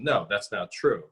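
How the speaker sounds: normal talking voice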